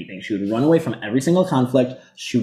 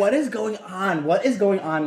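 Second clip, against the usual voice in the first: higher, slow, baffled what is going on; mock disbelief; 7 semitones higher than usual. Cohost retelling her baffled reaction to Meredith's rant. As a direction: stare at the screen lost. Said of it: "I was like".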